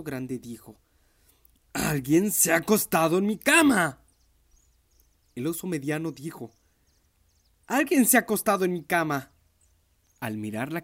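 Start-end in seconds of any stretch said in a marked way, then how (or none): 1.7-4.0 loud, high booming bear voice; big bear shouting. Acted. Papa bear finds someone used his bed, a loud shout. 7.7-9.3 mama bear. Acted. Mama bear finds bed used.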